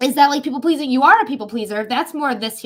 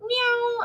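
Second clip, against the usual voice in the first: mock meow; 11 semitones higher than usual. Imitating a pet's meow.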